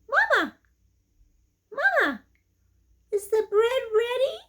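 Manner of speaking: very high, whiny little chick voices; tiny chicks pleading for bread; baby chicks. Acted. Baby chicks ask mama.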